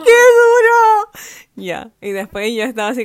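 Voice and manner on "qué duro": high, loud and slow; excited squeal. Retold or imitated. Recalling the concert, a high drawn-out excited squeal. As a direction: squeal it out, overwhelmed with joy. Retelling fangirl reaction.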